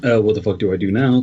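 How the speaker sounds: ordinary delivery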